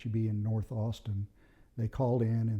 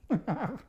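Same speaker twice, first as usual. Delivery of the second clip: brief high-pitched chuckle; a quick laugh to round off the story; short laugh; 11 semitones higher than usual. Real, not acted. Laughs after finishing the story.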